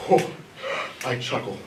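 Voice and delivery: normal talking voice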